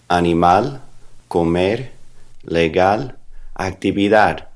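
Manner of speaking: a-ni-MAL, co-MER, le-GAL, ac-ti-vi-DAD, one at a time; teacher's word list, "animal, comer, legal, actividad" each stressed at the end; last-syllable stress. Shown as a demonstration. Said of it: "you're gonna stress the last syllable".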